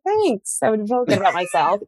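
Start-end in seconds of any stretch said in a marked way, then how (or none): 0.0-0.6 high, chirpy little thanks; pleased thanks. Retold or imitated. Flattered wife answering her husband's compliment, high and chirpy. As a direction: squeak it out, flattered. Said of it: "I would be like".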